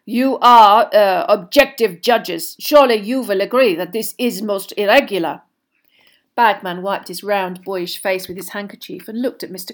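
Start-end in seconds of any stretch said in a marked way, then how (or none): 0.1-5.4 loud, oily appeal; ingratiating, with emphatic courtesy; unctuous. A narrator's reading. Headmaster flattering the objective judges, unctuously. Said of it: "his voice unctuous once more".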